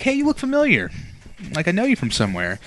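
high, eager fan recognising someone; excited stranger. Pretend. Stranger at the grocery store, recognising the podcaster, high and eager. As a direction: pitch it up like a fan spotting a celebrity.

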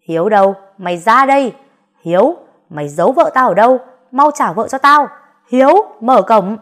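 shouting. Acted. Husband yells at the neighbour's gate for his wife, furious shout. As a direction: bellow at the gate for Hiếu. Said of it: "Có chuyện gì mà con la hét dữ vậy?".

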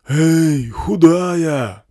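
high, loud bullhorn holler; drawn-out megaphone hail; yelling through megaphone. A narrator's reading. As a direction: holler after her through a hoarse loudhailer. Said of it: "заорали сзади в хриплый матюгальник".

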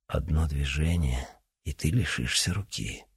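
soft, quiet calm threat. Acted. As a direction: threaten softly, unhurried. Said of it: "спокойно проговорил Тень".

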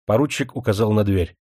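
ordinary delivery, nothing marked

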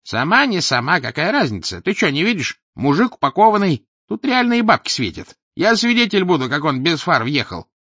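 high, loud scheming hustler; sly crowd voice. Acted. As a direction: push the girl to cash in. (подлый голосишка из толпы)

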